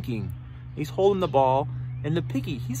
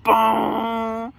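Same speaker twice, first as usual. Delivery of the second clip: long ringing "bong"; held cartoon thud on a single note; imitated bonk. Not good for the snake, imitated bonk.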